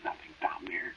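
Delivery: scared whisper in the dark; hushed, nervous whisper. Acted. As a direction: breathe it, barely voiced, afraid to be heard. Hushed fear by the well.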